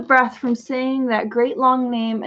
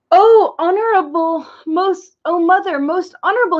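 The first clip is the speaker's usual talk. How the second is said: high, gasping for breath between words; a boy who ran all the way, panting; 6 semitones higher than usual. Acted. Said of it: "he panted".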